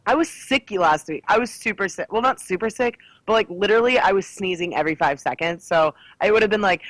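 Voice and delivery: ordinary talk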